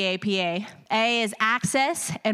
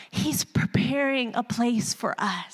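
ordinary talk, nothing marked

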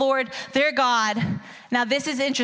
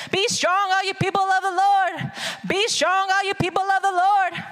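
preaching proclamation; 6 semitones higher than usual. Acted. Preacher repeating scripture with force.

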